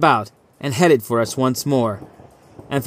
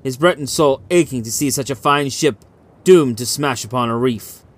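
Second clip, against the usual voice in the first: ordinary delivery, nothing marked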